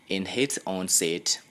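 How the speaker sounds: ordinary delivery, nothing marked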